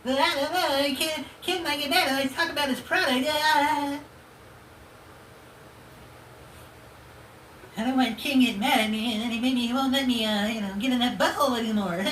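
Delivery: high timid cartoon-boy voice; high, nervous mock Butters. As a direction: play him as a nervous Butters. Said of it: "You're like Butters".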